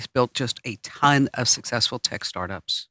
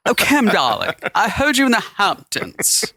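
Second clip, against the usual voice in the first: slow, higher society-lady gush; posh Hamptons listener; 6 semitones higher, slower than usual. Pretend. Imagined Hamptons fan gushing to the host, posh. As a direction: play a posh socialite. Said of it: "Is that what they're gonna sound like".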